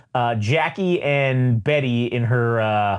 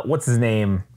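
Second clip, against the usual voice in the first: normal talking voice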